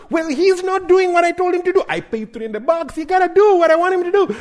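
high, loud indignant complaint; angry dad. Retold or imitated. Dad complaining angrily to his wife. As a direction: defend yourself, fuming. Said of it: "I am mad".

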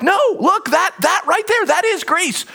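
high-pitched, exasperated insistence; emphatic correction. Acted. Locals correcting a lost traveler, emphatic and exasperated.

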